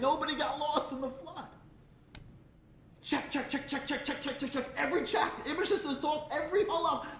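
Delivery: high, loud, driving sermon voice; a rabbi rousing the crowd about Noah, fervent preaching. Acted. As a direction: preach it to the rafters. Preaching at full force.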